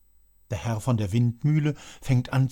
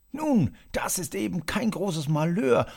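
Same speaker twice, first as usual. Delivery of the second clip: ordinary delivery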